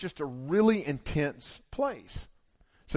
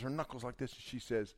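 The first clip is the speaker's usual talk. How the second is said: usual speaking voice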